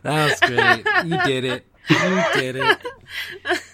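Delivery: cracking up while praising; squeaky laugh through the words. Real, not acted.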